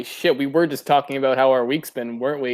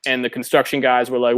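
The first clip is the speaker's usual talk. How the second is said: ordinary delivery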